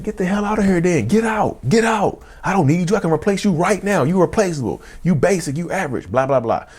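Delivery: high-pitched angry tirade, reenacted; heated, pitch way up, volume held back; retold screaming argument. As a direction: act out hurling insults at a partner. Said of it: "me and her screaming and yelling".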